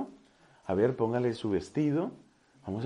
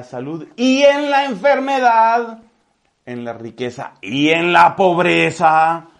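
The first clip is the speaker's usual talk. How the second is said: louder, higher priest pressing the groom; insistent priest; 5 semitones higher, 11 dB louder than usual. Acted. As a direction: demand an answer from the groom. Priest questioning the groom forcefully. (el sacerdote exige al novio una respuesta, más fuerte y agudo)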